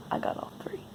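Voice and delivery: dry whisper. Real, not acted. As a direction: whisper it wryly.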